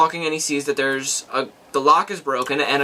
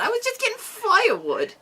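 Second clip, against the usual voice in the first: much higher, softly indignant imitation; mock protest; 4 dB quieter than usual. Retelling Thea's excuse to a co-host, high mock protest.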